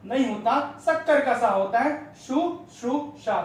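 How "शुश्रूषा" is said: शुश्रूषा said slowly and higher, each syllable apart; careful spelling-out. Shown as a demonstration. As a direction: draw out शुश्रूषा syllable by syllable, slow and high. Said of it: "शक्कर के सा में छोटू की मात्रा".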